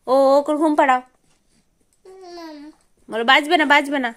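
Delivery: ordinary delivery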